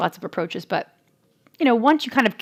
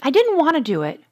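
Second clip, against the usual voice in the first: put-on higher, earnest kid voice; imitating son; 7 semitones higher, 3 dB louder than usual. Son telling mom at bedtime, higher earnest kid voice. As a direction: lift the voice into a sweet little boy. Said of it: "this is how he talks".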